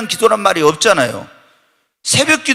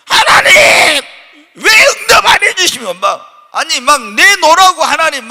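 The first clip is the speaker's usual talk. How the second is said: much higher and louder insistent demand; demanding prayer; 8 semitones higher, 6 dB louder than usual. Retold or imitated. A stubborn believer demanding an answer from God, loudly. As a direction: pound on heaven's door, demanding. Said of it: "막 이렇게 강청을 하면".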